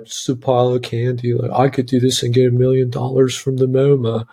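mocking Baltimore accent, dismissive art skeptic; mock Baltimore accent, lightly put on. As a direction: sneer it out like a Baltimore local scoffing at the art. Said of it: "I default to Baltimore accent when I do those kind of people".

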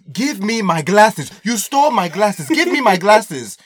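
loud, slow, high angry demand from a furious dad. Retold or imitated. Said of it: "He confronts this guy and says".

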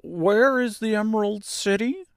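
high, innocent inquiry; puzzled scarecrow. A narrator's reading. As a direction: ask in a high, simple-minded voice.

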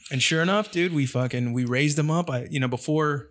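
ordinary talk, nothing marked

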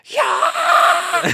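high unvoiced yell of steam; imitated scream. Coworker letting off steam, imitated scream. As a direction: let out a sudden yelled yeah. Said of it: "he just has to scream".

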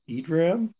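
IDRAM said with an "ee" opening; trying a pronunciation. Shown as a demonstration. Recapper offering another reading of the name, questioning pronunciation. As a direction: try IDRAM starting like "ee". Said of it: "Really hard to say".

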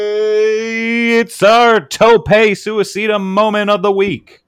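slow, drawn-out announcer call. Acted. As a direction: announce it like a big ring entrance. Announcing segment name, drawn out.

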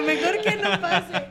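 slow, laughing mejor que no pase; drawn out through laughter; slow laughing plea. Real, not acted. Wife begs the host to skip her story, slowly laughing. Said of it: "Diego, que no lo cuente".

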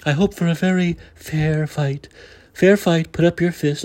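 lightly imitated, slow, overly controlled delivery; drawn-out, stilted mock of a calm politician; mock Trudeau. Mocking the prime minister's controlled voice, lightly imitated. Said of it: "his overly controlled voice".

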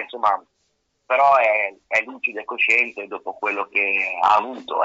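usual speaking voice

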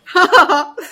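mocking laugh. Mocking the vampire question.

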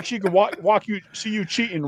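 normal talking voice

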